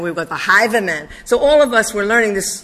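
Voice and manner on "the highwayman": "highwayman" with a v sound, imitating a Yiddish teacher; Yiddish accent. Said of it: "had such a heavy Yiddish accent".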